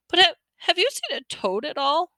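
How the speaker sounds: put-on worried boy hunting his lost toad; tearful boy. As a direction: ask as a nervous, teary kid. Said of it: "He looked tearful".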